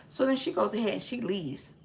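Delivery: usual speaking voice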